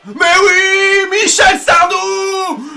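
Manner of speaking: crie. Real, not acted. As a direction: scande le nom comme un chant de supporter. Hurle [name] après un but. (il hurle [name] après son but, beaucoup plus fort)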